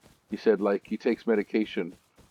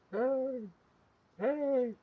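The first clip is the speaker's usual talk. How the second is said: high, soft distant yell; yelling from far away; 8 dB quieter than usual. Retold or imitated. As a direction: imitate a tiny faraway shout, high-pitched and small. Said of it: "yelling from far away".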